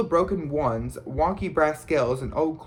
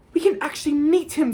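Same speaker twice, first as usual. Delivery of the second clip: very high, fast, louder squeal; excited squeal; 12 semitones higher, 5 dB louder, faster than usual. A narrator's reading. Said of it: "squealed Hermione".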